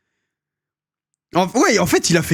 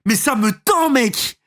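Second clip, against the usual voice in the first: exasperated; 7 semitones higher than usual. Real, not acted. Exasperated reaction to video voice.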